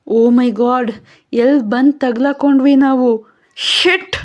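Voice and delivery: trapped and terrified; panicked. Acted. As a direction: Rashmi panicking about where they are stuck. Rashmi panics about being trapped.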